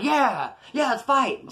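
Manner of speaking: high, cocky retold yes; eager challenge. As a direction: jump at the fight. Retold eager acceptance of a fight.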